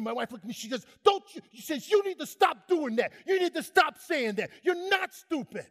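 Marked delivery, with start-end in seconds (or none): 1.0-1.4 rebuke. Retold or imitated. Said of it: "My wife rebuked me". 1.9-5.7 raised, insistent wife's rebuke, louder and higher; firm rebuke. Retold or imitated. Wife rebuking her husband for self-insults, firm raised voice. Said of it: "My wife rebuked me".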